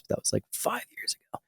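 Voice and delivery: whispered embarrassed aside. Real, not acted. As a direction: drop to a whisper, half-ashamed. Embarrassed aside about old feature.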